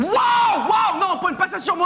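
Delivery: high startled yelp, backing away; alarmed shout. Acted. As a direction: jump back in alarm, loud and high. Suspect object handed to him.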